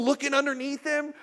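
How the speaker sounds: normal talking voice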